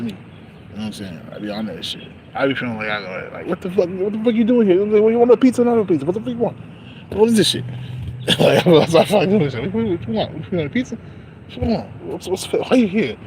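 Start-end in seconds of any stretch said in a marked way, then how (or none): 3.5-7.6 higher-pitched impatient New York counter guy. Acted. New York guy asking a customer's order, impatient. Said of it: "I feel like a New York". 9.7-13.2 New York guy. Acted. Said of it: "I feel like a New York".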